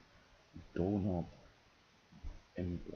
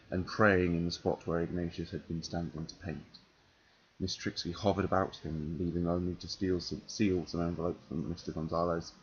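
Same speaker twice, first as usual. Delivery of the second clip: reading aloud; 4 dB louder than usual. Acted. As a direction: read the novel passage to an audience. Reading a passage from a novel.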